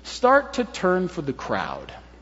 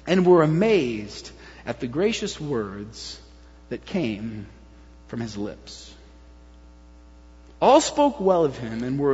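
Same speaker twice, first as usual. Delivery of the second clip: slow, measured reading, softening at phrase ends; scripture reading; 7 semitones lower, 3 dB quieter, slower than usual. Acted. Preacher reading Luke's verse to the congregation, slow and hushed at the close. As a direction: read it slowly and let each phrase sink away. Reads the scripture passage aloud.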